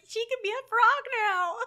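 high, loud imitation of happy kids; mock childlike glee. Podcaster voices girls who are not scared, mock glee. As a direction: chirp it loudly like a delighted little girl. Retells unafraid girls celebrating.

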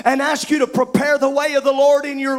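rising, shouted preacher's cadence; full-force preaching. Acted. Preacher to his congregation, full-force preaching. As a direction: drive it home to the pews.

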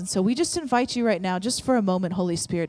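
loud, declaring prayer against every lie; fervent prayer. Acted. Pastor declares over the congregation, loud and fervent. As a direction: come against the lies with full voice. Prays against lies, music.

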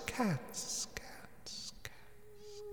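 whispered echo fading out; unvoiced echo dying away. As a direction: let it fade like a spooky reverb. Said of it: "I've done that because I can't be bothered to put an edit in".

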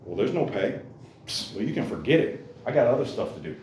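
soft, dismissive mock brush-off; dismissive shrug-off. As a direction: wave it away like it is not worth the bother. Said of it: "That was their attitude".

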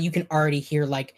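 ordinary delivery

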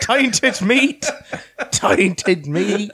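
high, laughing mock outcry; a laughing mock yell of tainted meat; mock cry. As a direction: shout it out half laughing. Mock outrage over eating.

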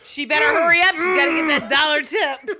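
put-on bossy holler; mock impatient yell. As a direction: shout it like an impatient customer. Mocking the impatient college customer.

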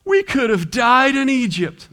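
high, mocking whine of the grumblers; whiny complaining Israelites. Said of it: "that's a great speech".

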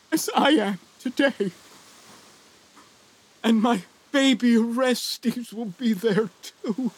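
father voicing his daughter's high, piteous plea; daughter pleading. Father imagines his daughter begging him not to kill her, piteous and high. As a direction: beg for your life as the young daughter. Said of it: "I can hear her pleading to me piteously".

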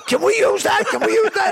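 high, eager and laughing; eager director voice. Retold or imitated. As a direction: pitch it up like an excited director.

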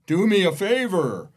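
big booming voice from above. Retold or imitated. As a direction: boom it out like God from the rafters. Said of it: "this giant booming voice".

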